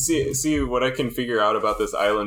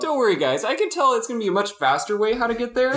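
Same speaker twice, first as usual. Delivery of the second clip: high, goofy character voice; silly high-pitched boast; mock dim-witted plotter; 10 semitones higher than usual. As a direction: pitch it way up, cheerfully overconfident. Mimicking the stupid frog people.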